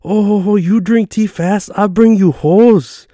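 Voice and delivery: put-on high, loud Chinese-accented waitress, drawn out. Waitress ribbing a customer over his tea, Chinese accent. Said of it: "And that was her voice too".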